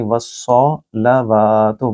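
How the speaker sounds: वस्सलावातु very slowly, with the वा stretched; long vowel. Shown as a demonstration. Teacher isolating the word, very slow recitation. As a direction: drag out the वा. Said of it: "वाव को लंबा करेंगे".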